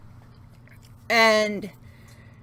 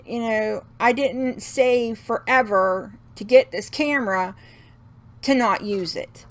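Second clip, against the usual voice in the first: normal talking voice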